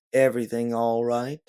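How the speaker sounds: lightly voiced husky Southern drawl; a drawled check-in from a Southerner. A narrator's reading. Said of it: "a husky drawl comes from behind you".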